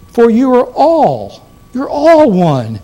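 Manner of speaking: slow, high and loud on "all"; stress on all. Shown as a demonstration. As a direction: draw out "all" and "one" with weight. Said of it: "Here's the way Paul said it".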